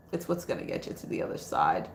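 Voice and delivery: a low whispered echo of the line. Shown as a demonstration. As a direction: repeat it in a breathy whisper. Repeated line, now whispered.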